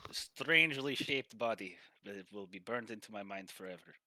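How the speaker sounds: put-on appreciative Russian accent; Russian masseur. Masseur thanks the elf for money, Russian accent. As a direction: praise grandly in a Russian accent. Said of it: "Oh, he goes".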